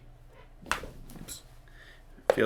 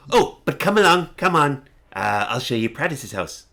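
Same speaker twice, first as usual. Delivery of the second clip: put-on eager old man; 3 semitones higher than usual. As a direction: hurry the guests along happily. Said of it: "he's, like, really happy".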